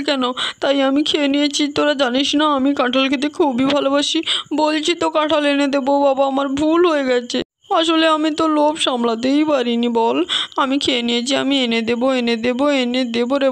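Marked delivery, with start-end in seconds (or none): none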